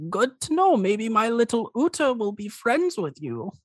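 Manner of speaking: put-on high-pitched elderly dragonborn with a European accent; old dragonborn man. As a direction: greet them as a high-voiced old uncle. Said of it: "and the old man just goes".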